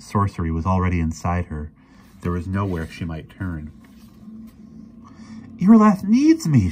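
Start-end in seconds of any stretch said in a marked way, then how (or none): 5.6-6.7 very high, loud, desperate cry; urgent, anguished outburst; desperate plea. A narrator's reading. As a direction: plead as if it is the last argument left. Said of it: "she tried desperately".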